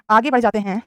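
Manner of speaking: ordinary talk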